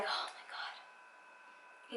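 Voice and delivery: slow, awed whisper. Retold or imitated. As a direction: breathe it out in delight. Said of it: "I'm like".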